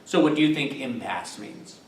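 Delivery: ordinary talk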